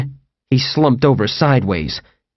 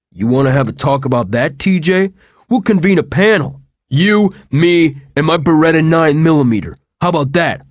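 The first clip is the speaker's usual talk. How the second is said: threatening TJ; 4 semitones higher than usual. Acted. TJ to Owen mentioning his Beretta, mock-menacing. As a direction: offer the panel like a veiled threat.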